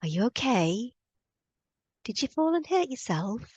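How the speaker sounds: a hint of put-on fussing over a toddler; imitated concerned stranger. Woman picks up fallen little girl, imitated concern. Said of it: "picks her up and goes".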